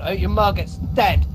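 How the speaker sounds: ordinary talk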